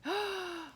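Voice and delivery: high, sharp gasp of dismay; storyteller's dramatic gasp; shocked gasp. A narrator's reading. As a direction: gasp in horror. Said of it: "Isaac started to get all upset".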